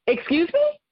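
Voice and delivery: affronted disbelief; first shocked excuse me, rising; indignant excuse me. Retold or imitated. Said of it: "I was furious".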